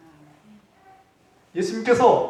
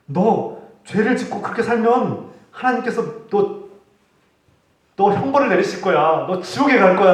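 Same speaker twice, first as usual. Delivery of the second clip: louder, rising threat of hell; mock threat; 5 semitones higher, 4 dB louder than usual. Preacher threatening a sinner with hell, mock threat. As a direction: threaten him with punishment. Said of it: "라고 협박하지도 않으셨어요".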